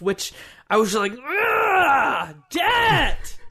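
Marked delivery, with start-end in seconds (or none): 1.1-3.4 high-pitched exasperated growl into a cry; frustrated outburst. Retold or imitated. Player reliving being trapped into work, high frustrated outburst. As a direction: groan and curse in mock fury. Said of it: "super frustrating".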